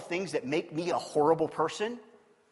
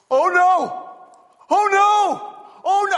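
high, loud mock panic "oh no"; hammed-up panicked cries, much higher and louder; panicked roommate; 13 semitones higher, 12 dB louder, slower than usual. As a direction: yelp it like a scared roommate. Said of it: "I hear this".

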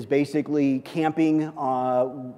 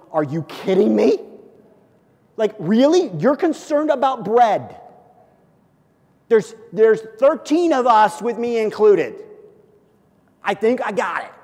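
exasperated; 6 semitones higher, 6 dB louder than usual. Retold or imitated. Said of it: "can you imagine Jesus, like, going".

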